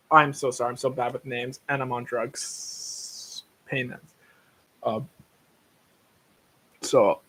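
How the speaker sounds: ordinary talk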